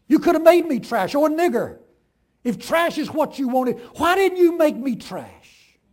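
very loud, high accusing cry; railing. A narrator's reading. The farm wife accuses God of choosing, loud. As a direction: scream the charge at God. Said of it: "she railed".

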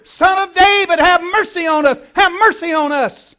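loud, high desperate pleading. Retold or imitated. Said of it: "They cried out even louder".

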